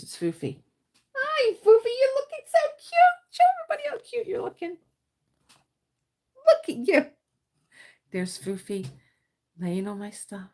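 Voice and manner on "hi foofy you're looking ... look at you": very high-pitched cooing to a pet. Real, not acted. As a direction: coo loudly at your pet, way up high. Cooing to her pet Foofy.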